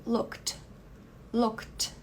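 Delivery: looked sem E, direto pro D forte; "Looked" terminando num D bem marcado; D forte no final. Shown as a demonstration. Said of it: "é bem forte esse D no final". ("Looked" com D final forte)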